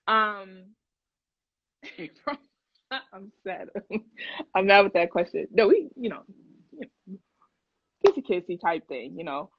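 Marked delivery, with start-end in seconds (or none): none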